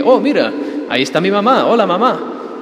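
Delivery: mock casual greeting. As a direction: toss off a light, chirpy hello to mom. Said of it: "No es un simple que". (saludo casual burlón, más agudo y fuerte)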